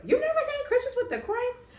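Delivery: high-pitched mock astonishment; shocked high-voiced quote; incredulous retold question. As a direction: squeal it in disbelief, imitating her. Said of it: "She's like".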